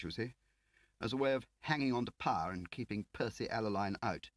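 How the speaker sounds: a prim Whitehall man; fussy official Lakin. Acted.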